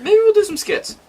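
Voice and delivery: high playful excited pitch. Real, not acted.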